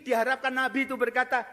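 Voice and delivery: ordinary talk, nothing marked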